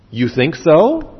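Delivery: loaded, sceptical rising question; insinuating doubt. Shown as a demonstration. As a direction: cast doubt with a drawn-out question.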